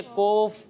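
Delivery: कोफ with the vowel rounded right into O; over-thickened Qaaf. Shown as a demonstration. Teacher warning pupils, an over-rounded wrong कोफ. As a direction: overdo the heaviness so it becomes कोफ. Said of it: "इतना ज़्यादा मोटा नहीं पढ़ना".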